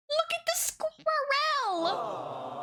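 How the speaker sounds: put-on very high, slow baby-talk coo; cutesy cooing. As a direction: coo over it like a baby picture. Cooing over squirrel photo.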